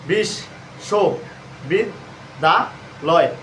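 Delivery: বিশ, শো, বিদ, দা, লয় each set apart with a half-second pause; one syllable, pause, the next syllable; broken into syllables. Shown as a demonstration. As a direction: tap out every syllable separately, like a teacher counting. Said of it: "এইভাবে ভেঙে ভেঙে উচ্চারণ করলে".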